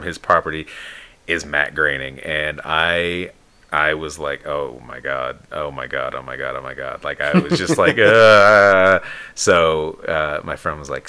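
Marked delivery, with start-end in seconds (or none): 4.5-7.0 low, hushed starstruck chant. Retold or imitated. Retold starstruck reaction on seeing idol. 8.0-9.0 loud, drawn-out starstruck "uhhh"; hammed-up wordless freak-out noise; overwhelmed mock groan. Imitates own overwhelmed reaction.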